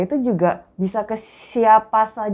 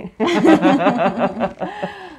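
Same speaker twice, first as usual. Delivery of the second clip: burst of laughter; 9 semitones higher than usual. Real, not acted. Co-host reacting to the teacher example, high burst of laughter. Amused by the guru remark.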